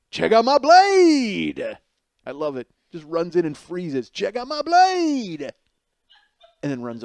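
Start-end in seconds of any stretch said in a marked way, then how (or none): 0.1-1.8 high, very loud, drawn-out yell; show-off battle cry. Retold or imitated. Eleven-year-old bursts into Dunkin' Donuts, bragging yell. Replays boy's knife boast. 4.1-5.5 high, very loud, drawn-out yell; show-off battle cry. Retold or imitated. Eleven-year-old bursts into Dunkin' Donuts, bragging yell. Replays boy's knife boast.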